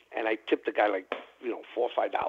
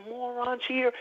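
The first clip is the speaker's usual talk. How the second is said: much higher, strained mock cursing; retold cursing rant; 13 semitones higher, 4 dB quieter than usual. Dealer cursing out the players, high-pitched mock rage. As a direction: spit it out like a dealer who has snapped. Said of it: "he just cursed out the players".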